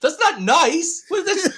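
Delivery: high, loud mock protest; mock indignation. Dad acts out protest at son.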